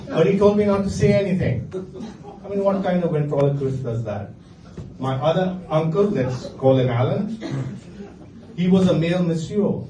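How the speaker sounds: ordinary delivery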